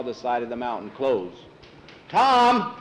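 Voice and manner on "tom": high, loud holler; urgent call. Acted. Cowhand calling Tom back to the mountain, urgent. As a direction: shout to bring him running.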